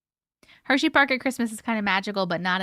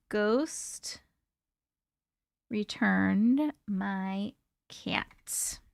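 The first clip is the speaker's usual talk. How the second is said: slow whispered title; whispering; 7 dB quieter, much slower than usual. Acted. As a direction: breathe the title out slowly. Reading story title.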